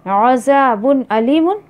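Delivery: slow recited अज़ाबुन अलीमुन; measured Quranic recitation. Shown as a demonstration. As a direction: recite it slowly like tilawat. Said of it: "अल्लाह ताला बोल रहे हैं".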